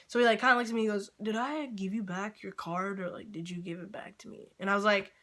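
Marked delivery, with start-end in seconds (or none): 1.2-4.5 mock bewildered mumble; dazed and confused. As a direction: imitate a kid who has lost track, trailing very quiet. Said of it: "like, dazed and confused".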